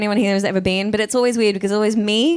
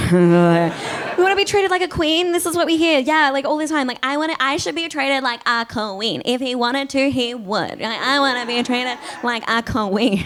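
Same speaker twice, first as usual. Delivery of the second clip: entitled single lady; 4 semitones higher than usual. Retold or imitated. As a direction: whine like someone demanding royal treatment. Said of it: "She's like".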